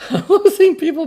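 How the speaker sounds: high, laughing mock wail; losing viewers, laughing it off; laughing mock dismay. Real, not acted. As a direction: play up the loss through a laugh. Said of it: "I'm pissing off Tesla fanboys".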